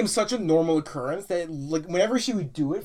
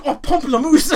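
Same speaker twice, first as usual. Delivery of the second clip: high, louder, laughing fake French; mock French teacher; 6 semitones higher, 7 dB louder than usual. Friends joking about the teacher, in a laughing mock French voice. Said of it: "and she's like".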